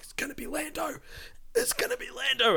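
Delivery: high, hushed eager chant to a friend; excited hush. Retold or imitated. Grabbing his friend Matt in the cinema, high hushed excitement. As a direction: lean over and tip off a friend.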